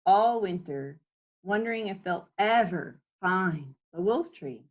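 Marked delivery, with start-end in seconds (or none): none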